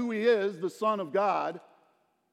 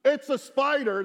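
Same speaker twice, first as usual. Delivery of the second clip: high, loud imitation of frightened daughters; mock terrified scream; 8 semitones higher, 6 dB louder than usual. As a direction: act out a spider panic, hammed-up. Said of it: "screaming out".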